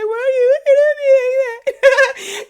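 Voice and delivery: high, loud and teary with a laugh; crying and laughing at once, overwhelmed with love; laughing through tears. Retold or imitated. Aunt to staring baby nephew, teary high laugh-cry. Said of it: "boohoo and crying".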